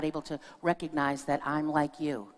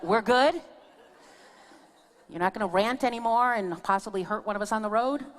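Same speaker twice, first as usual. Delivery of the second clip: lightly mocking check-in; 5 semitones higher, 4 dB louder than usual. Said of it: "I looked at him and I go".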